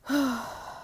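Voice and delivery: long breathy sigh; frustrated sigh. Real, not acted. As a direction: let out a long exasperated breath. Frustrated after a flubbed line.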